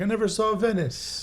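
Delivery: mock dying regret. Explaining the cliche to friends, high dying lament. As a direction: sigh it out as a last breath.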